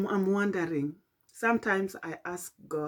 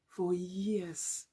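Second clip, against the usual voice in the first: hushed whispered echo; 7 dB quieter than usual. Real, not acted. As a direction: breathe it out as a whisper. Whispered repeat of "for years.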